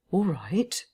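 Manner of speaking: usual speaking voice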